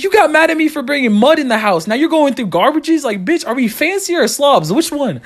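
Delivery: high, indignant comic rant; a high-pitched mock-indignant rant. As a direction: call out mom's hypocrisy. Ranting at mom.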